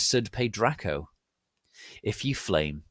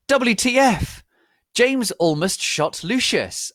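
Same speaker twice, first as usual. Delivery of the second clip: shocked shriek; 13 semitones higher, 8 dB louder, slower than usual. A narrator's reading. Heroine shocked outburst.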